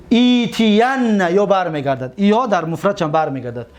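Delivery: usual speaking voice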